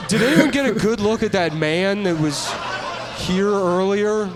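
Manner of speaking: a baffled witness asking around, laughing; mock puzzled bystander. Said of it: "who's gonna be like".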